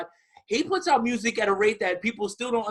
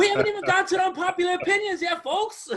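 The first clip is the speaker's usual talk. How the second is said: much higher, playful host voice; high teasing; 12 semitones higher than usual. Real, not acted. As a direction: tease the audience in a high voice. Teasing host aside to listeners.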